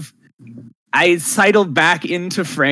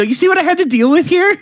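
exasperated; 12 semitones higher, 4 dB louder, faster than usual. Acted. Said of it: "I go".

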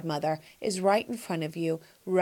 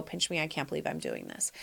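hushed disbelief; 5 semitones lower, 11 dB quieter than usual. Retold or imitated. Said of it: "you go".

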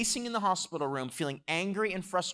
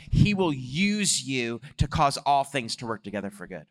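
raised, driving pulpit voice; impassioned preaching; 2 semitones higher, 4 dB louder than usual. Real, not acted. Preacher urges church to rejoice in God, impassioned preaching.

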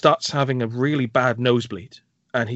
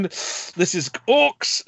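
mock movie line; 6 semitones higher, much slower than usual. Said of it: "him going".

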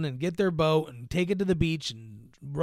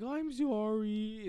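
sheepish apology; 6 semitones higher, 8 dB quieter, slower than usual. Retold or imitated. She apologizes after wasting flare.

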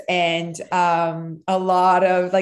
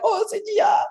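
much higher, delighted mock cry; husband spotting daughter on screen; excited recognition; 13 semitones higher than usual. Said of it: "And Jeff was like".

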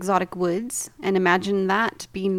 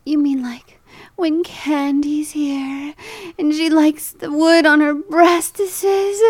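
breathy, higher sultry purr; 6 semitones higher, 3 dB louder, slower than usual. Shown as a demonstration. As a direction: seductive whisper rising to purr. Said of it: "say it with a more sultry tone".

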